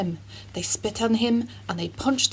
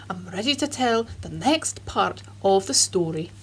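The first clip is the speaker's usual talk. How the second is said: ordinary delivery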